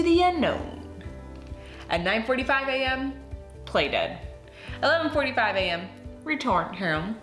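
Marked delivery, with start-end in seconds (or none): none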